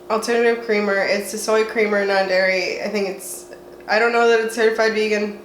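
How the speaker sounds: usual speaking voice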